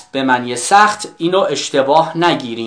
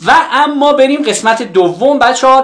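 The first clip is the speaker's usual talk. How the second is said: upbeat announcement; 8 semitones higher, 4 dB louder, faster than usual. Real, not acted. Teacher announces next section.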